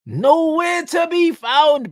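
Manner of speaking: outraged, drawn-out echo; incredulous shout. Real, not acted. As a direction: shout it back in disbelief. Incredulous at guest's answer.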